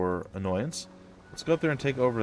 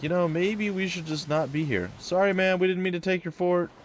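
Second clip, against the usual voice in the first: higher, sheepish apologetic voice; voicing enemy hero; 7 semitones higher than usual. Enemy hero apologizing for taking the fort, sheepish higher voice. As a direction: play the enemy backing off, a bit higher. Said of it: "He's like".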